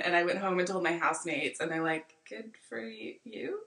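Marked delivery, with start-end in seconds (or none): 2.3-3.6 unimpressed congratulations. Retold or imitated. Housemates' unimpressed reaction retold.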